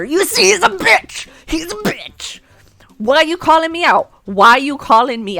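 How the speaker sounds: high, loud outraged shout; outraged yell. Real, not acted. Outraged at professor.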